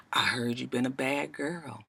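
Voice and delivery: soft, breathy threatening whisper; menacing whisper. Retold or imitated. Basie Skanks cornering Tasha.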